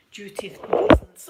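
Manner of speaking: ordinary delivery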